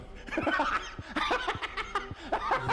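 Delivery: a burst of high-pitched laughter. Real, not acted. Laughing at his own alien trip story, high laugh.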